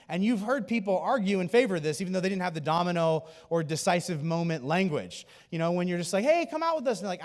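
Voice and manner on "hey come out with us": high, upbeat invitation; convite animado. Acted. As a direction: call a friend out, pitched way up. Voicing a friend's invitation.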